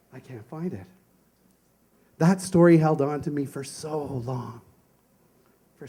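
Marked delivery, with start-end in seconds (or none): 0.1-0.9 put-on much softer, small lost child's voice; whisper-soft defeat of a nine-year-old; small boy's soft despair. Small boy at the workbench.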